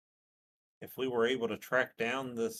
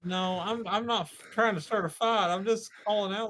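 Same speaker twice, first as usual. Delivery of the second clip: excited explaining; 11 semitones higher, 7 dB louder than usual. Real, not acted. Player explains amid laughter.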